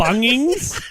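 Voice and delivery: loud, high, rising disbelief through a laugh; incredulous echo. Real, not acted. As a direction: throw it back as an amused, astonished question. Incredulous echo of misspelt store name.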